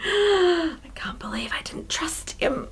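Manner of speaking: slow, hushed self-reproach; whispered anxious aside; guilty whisper. Acted. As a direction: act out horrified regret under the breath. Acting Thomas's guilty inner thought.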